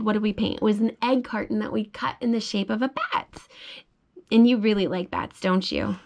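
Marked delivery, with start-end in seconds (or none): none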